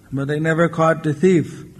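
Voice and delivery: plain reading voice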